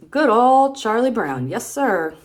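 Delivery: hammed-up boy calling out a greeting; cheery comic-strip boy. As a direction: pour on the fake praise. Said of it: "this guy says".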